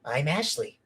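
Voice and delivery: softly put-on character catchphrase; a hint of a one-line character, quietly; Ashley. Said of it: "He doesn't say anything else".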